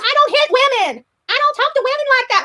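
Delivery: hammed-up imitation of his interview line; quoting him. Streamer mocking a man's interview denial. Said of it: "an interview where he says".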